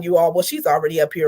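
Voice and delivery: ordinary delivery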